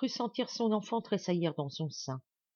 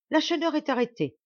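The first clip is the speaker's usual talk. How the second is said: high, loud sudden outburst; cried out; 10 semitones higher, 6 dB louder than usual. A narrator's reading. Martial crying out to Marianne, high and loud. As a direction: blurt it out with a jolt, much higher and louder. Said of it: "s'écria-t-il".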